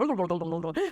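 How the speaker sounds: rapid rolling babble, imitating fast Kerry speech; garbled fast-talk noise. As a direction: babble nonsense syllables at top speed. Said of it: "We're quite fast".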